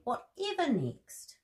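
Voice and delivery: drawn-out, fondly amused; Mrs Bear's voice. Acted. Mrs Bear voiced in story.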